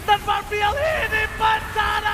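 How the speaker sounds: loud, slow dramatic finish call; hype shout. Real, not acted. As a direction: roar the final moment slowly. Caster calls final defeat.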